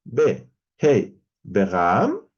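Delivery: "Be, Hei, Begam" slow, loud and broken apart; drawn-out "Be, Hei, Begam" in separate pieces; alternative Hebrew reading. Shown as a demonstration. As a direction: spell out "Be... Hei... Begam" deliberately. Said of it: "si leyéramos".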